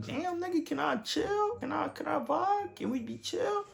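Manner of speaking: very high-pitched comic plea; mock pleading. Retelling his reaction to being laughed at, high comic pleading. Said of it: "I was just like".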